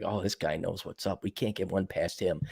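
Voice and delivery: put-on hushed, impressed kid voice; kids voiced quietly. Kids grudgingly admitting defeat, hushed. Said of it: "they're like".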